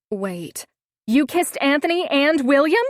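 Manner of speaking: high, loud shocked shriek. A narrator's reading. Friend shrieking at the news of the kisses. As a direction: squeal it out in disbelief. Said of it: "she shrieked".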